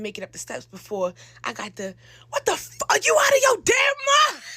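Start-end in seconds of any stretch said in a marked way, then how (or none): 2.3-4.4 high, loud mock-furious mother shout; mother yelling. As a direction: blast it like an outraged mom.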